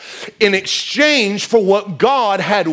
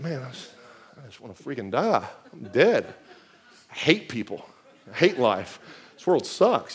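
exhausted grumbling; 9 semitones lower, 10 dB quieter than usual. Retold or imitated. Worn-out worker home from a long day, drained mutter. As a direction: lower and much softer, a drained mutter. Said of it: "You're like".

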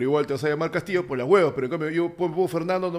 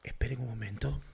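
low, soft hushed ASMR voice; 5 semitones lower, 6 dB quieter than usual. Pretend. Asking viewers for likes in a soft low ASMR voice. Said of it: "tú A S M R".

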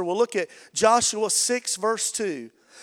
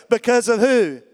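bigger, louder ask for the answer; louder call and response; 3 semitones higher, 6 dB louder than usual. Real, not acted. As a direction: push the crowd to shout back. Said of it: "Y'all gotta do better".

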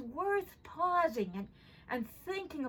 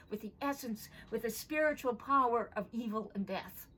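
normal talking voice

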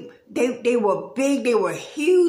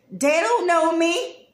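God quoted, emphatic; 6 semitones higher, 4 dB louder than usual. Retold or imitated. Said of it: "He says".

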